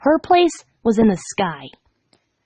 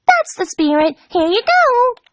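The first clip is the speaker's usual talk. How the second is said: high, bouncy and thrilled; bubbly Pinkie voice; 11 semitones higher, 3 dB louder, slower than usual. A narrator's reading. Pink pony greeting her friend, high and bouncy. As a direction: squeal it like an overexcited pink pony.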